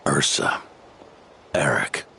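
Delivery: breathy whisper of a hated name. Acted. Old knight whispers the traitor's name to himself. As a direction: let the name slip out under breath.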